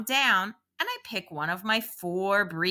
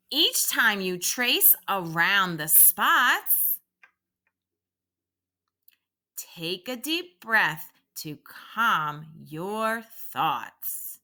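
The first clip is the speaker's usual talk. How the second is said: nice and slow; slower than usual. Shown as a demonstration. Teacher guiding children slowly through the calming breath line. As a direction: draw it out so they can follow. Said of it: "I will say it nice and slow".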